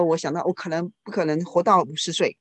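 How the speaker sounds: ordinary talk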